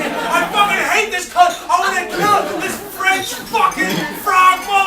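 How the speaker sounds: high loud furious roar. Retold or imitated. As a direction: bellow your hate at the screen. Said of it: "all of us were just roaring at the T V".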